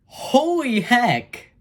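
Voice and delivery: high, drawn-out amazement; stunned fan reaction, long and high; awestruck. Real, not acted. Amazed by the post-credit twist.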